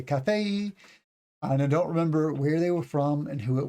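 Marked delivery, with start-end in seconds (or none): 0.0-0.7 "Cafe y" drawn into ka-fay-ee, higher; imitated mispronunciation. Teacher quoting someone he heard, high odd pronunciation. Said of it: "I've actually heard someone say".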